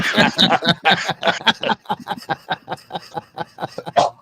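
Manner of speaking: drawn-out high giggling fit; long high laugh. Real, not acted. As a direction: keep cracking up, high and helpless. Keeps laughing at wrestling idea.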